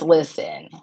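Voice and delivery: ordinary talk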